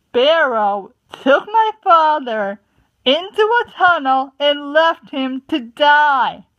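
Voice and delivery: loud, bitter accusation; angry accusation. A narrator's reading. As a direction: hurl the blame, slow at first. Tall Paw accuses Sparrow.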